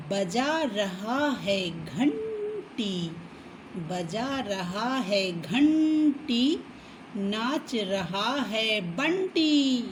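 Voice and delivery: put-on slow sing-song rhyme for kids; slow chanting of a children's poem; children's rhyme reading. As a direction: read it out slowly like a nursery poem.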